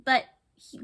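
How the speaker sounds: usual speaking voice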